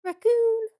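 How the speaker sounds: very high, loud sing-song squeak; sing-song it in a tiny voice. Retold or imitated. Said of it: "in the tiniest little sing-song voice goes".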